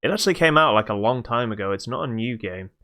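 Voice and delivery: ordinary talk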